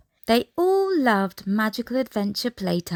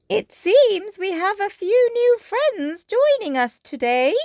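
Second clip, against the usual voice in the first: Madame Gazelle voice; 11 semitones higher, 5 dB louder than usual. A narrator's reading.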